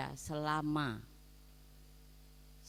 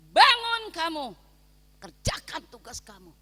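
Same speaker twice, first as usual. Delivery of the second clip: God's command; 4 semitones higher, 15 dB louder than usual. Retold or imitated. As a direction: order him out of bed. Voices God commanding him.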